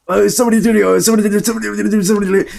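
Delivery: high, loud mock gibberish Rick; fake Arabic Rick voice. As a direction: shout it like a dubbed cartoon. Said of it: "I would speak like that".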